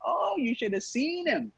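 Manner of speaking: high, drawn-out mocking retelling; milk the story, stretching the key word; imitated uncle hamming up his favourite tale; uncle's teasing storytelling. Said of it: "my uncle is the one telling the story".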